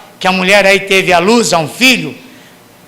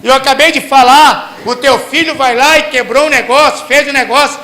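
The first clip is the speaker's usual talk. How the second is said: loud, high, fervent sermon delivery; full-force preaching; 8 semitones higher, 7 dB louder than usual. Acted. Preacher rousing the congregation, full-force preaching. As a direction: preach it out loud to the whole church.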